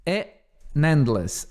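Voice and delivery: ordinary delivery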